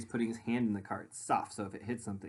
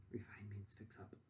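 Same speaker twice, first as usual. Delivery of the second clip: soft unvoiced explaining aside; whispered aside; 2 semitones lower, 16 dB quieter than usual. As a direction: slip the meaning in under your breath. Quick aside to the kids.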